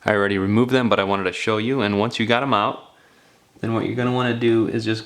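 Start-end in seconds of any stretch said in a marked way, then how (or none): none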